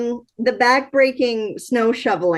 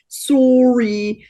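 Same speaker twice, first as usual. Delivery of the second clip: put-on Canadian accent, "sorry" drawn out and louder; 3 dB louder than usual. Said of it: "the hard accent of".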